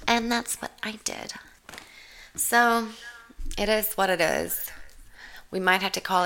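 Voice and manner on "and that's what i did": usual speaking voice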